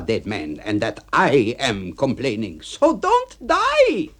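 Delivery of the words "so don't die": much higher, louder, slow yell; yelling back. Acted. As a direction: shout it back at him. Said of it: "he yells back at me".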